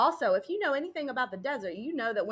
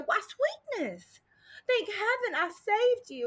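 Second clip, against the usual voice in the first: high drawl; 8 semitones higher, slower than usual. A narrator's reading. Hero greets rescuer, drawled high.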